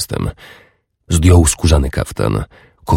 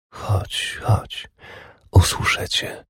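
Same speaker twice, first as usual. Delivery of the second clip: hushed, softer, coaxing; tender whisper; 3 semitones higher, 9 dB quieter, slower than usual. A narrator's reading. Urdis coaxing her lover in a whisper. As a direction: coax him in a whisper.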